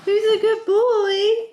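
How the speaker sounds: high, slow cooing praise. Real, not acted. Mother praises her baby.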